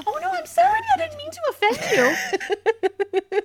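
high, squeaky mock apology. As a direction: imitate a squeaky-voiced busybody apologizing. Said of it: "little Miss Squeaky Voice".

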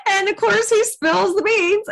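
ordinary delivery

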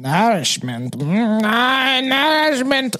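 savoring alien creature voice. Acted. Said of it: "you did a good job".

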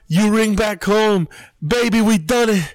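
high, crowing boast; Conor McGregor. Retold or imitated. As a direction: crow it like a triumphant fighter. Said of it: "it's like Conor McGregor".

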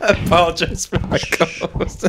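laughing through an apology; giggling as the words come out. Real, not acted. Co-host apologizing for his partner, laughing.

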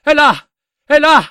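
high, loud, slow frenzied shouting; frantic screamed confession. Acted. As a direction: scream it out in mad desperation. Confessione urlata agli ufficiali. (confessione urlata, frenetica e acuta)

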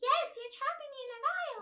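tiny high squeaky pixie. Pretend. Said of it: "Can you say it in the pixie voice?".

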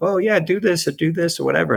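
breezy high-pitched brush-off; glib advice. Retold or imitated. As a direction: toss off advice lightly, pitch up. Mocking his own glib advice.

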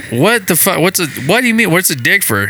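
mild puzzled protest; confused retold outburst. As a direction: sound thrown by the joke. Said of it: "And I said".

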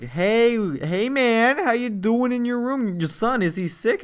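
high, loud put-on neighborly hail; mock friendly check-in. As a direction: call out with exaggerated casual concern. Parents confront man, feigning friendliness.

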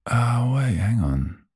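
usual speaking voice

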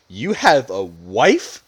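drawn-out, swooping disbelief; incredulous question. Retold or imitated. Retelling her finding out he was married, mock incredulous. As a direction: stretch the question in mock shock. Acts her shocked discovery.

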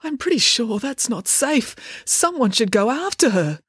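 mild flustered fretting; bewildered dad voice. A narrator's reading. Dad hesitating at the burning house, bewildered voice.

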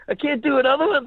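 loud, high incredulous protest; mock disbelief. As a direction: protest high and loud, replaying your shock. Protests being refused another take.

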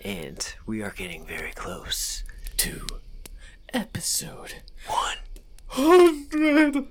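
hushed, drawn-out build to a high pop; slow suspenseful whisper rising high at the end; odd dramatic announcement. Pretend. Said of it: "why I said it like that".

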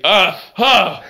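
sharp gasping cries from the smelling salts; a buddy reacting to smelling salts, mock gasps; mock pained gasps. Imitating Matt after sniffing.

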